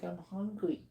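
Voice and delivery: high, soft voice speaking for the dog; a lightly voiced pleading puppy; dog's inner voice. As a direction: give the hungry dog a high little voice. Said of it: "He's like".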